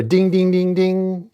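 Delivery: high, sing-out dings; repeated pitched ding sound; imitated bell dings. A narrator's reading. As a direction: mimic a slot machine chime. Imitates the slot machine chime.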